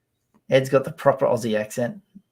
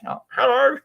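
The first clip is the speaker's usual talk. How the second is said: high, put-on silly greeting; pitched way up, mocking own voice; unwanted high voice; 12 semitones higher, 3 dB louder than usual. Shown as a demonstration. Showing how he doesn't want to sound, high. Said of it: "I don't wanna sound like".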